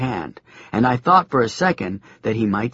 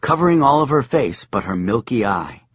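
ordinary delivery, nothing marked

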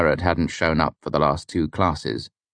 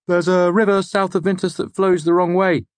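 high-pitched student voice, louder; Fenton; 5 dB louder than usual. Acted. Student giving the lecturer an interesting fact, high voice.

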